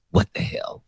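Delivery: whispered, resigned what the hell; whispered shrug. Real, not acted. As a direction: toss it off in a whisper. Resigned aside.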